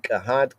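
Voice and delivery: first reading Kehat, light rise at the end. Shown as a demonstration. Teacher comparing two readings of a name. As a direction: say Kehat, short e in the first syllable. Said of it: "Kehat or Kohat".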